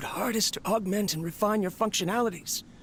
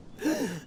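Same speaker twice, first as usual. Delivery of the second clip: weak cough; 4 semitones higher than usual. Acted. Collapsing from heart failure.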